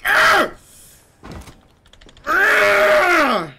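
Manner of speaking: frustrated yell. Real, not acted. Frustrated scream.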